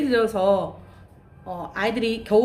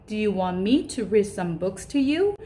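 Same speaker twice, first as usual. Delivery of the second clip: very slow, clear model sentence; slow teaching demo; much slower than usual. Shown as a demonstration. Teacher modelling a question for daycare children, very slow and clear. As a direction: spell each word out for learners. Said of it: "라고 말씀하실 수 있어요".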